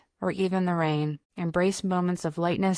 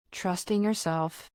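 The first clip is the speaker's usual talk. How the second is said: normal talking voice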